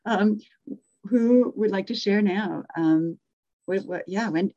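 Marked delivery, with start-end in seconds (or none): none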